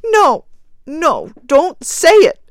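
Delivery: high, loud, frantic refusal; wild cry. A narrator's reading. Grieving woman stopping her husband, crying out wildly. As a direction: cry out to stop the words. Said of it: "cried the woman wildly".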